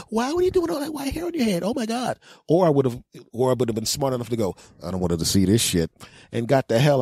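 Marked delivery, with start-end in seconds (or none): 0.1-2.2 high, rushed astonishment; mock shocked. Woman reacting to the revealed disguise, mock shocked. 4.8-5.9 muttered resolve. Retold or imitated. His thought, hiding from her.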